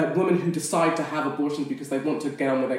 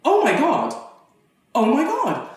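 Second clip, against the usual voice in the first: shocked realisation; 10 semitones higher, 4 dB louder than usual. Retold or imitated. Realising she is pregnant.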